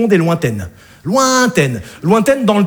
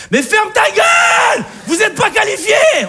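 very high, slow shouted "shut up"; angry outburst; 12 semitones higher than usual. Acted. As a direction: explode at them in mock fury. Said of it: "là je m'énerve". (cri de colère exagéré, très aigu)

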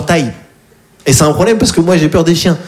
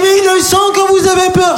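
dog owner lady. Retold or imitated. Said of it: "Elle dit". (la maîtresse du chien, voix très aiguë et lente)

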